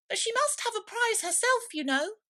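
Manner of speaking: high, squeaky little mouse. A narrator's reading. Mouse pressing the dodo about Alice, high squeak. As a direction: pitch way up as the timid mouse. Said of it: "said the mouse".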